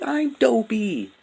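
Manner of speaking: very high, slow put-on voice; imitating Dopey. Imitating Dopey repeating his name, slow and very high. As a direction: stretch it out high and dazed. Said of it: "he's like".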